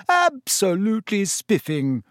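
overdone posh praise; mock posh toff. A narrator's reading. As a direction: send up a stuffy gent. George mocks Percy.

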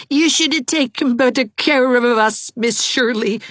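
put-on high boy's voice, scolding; a shivering child blaming his teacher; high, whiny boy blaming Miss Shirley; chattering reproach. A narrator's reading. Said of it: "still chattering".

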